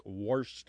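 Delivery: "washed" said as "worshed"; intrusive R. Shown as a demonstration. Host giving dialect examples, intrusive-R pronunciation. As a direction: put an R before the sh in "washed". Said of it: "mention that the creek washed out".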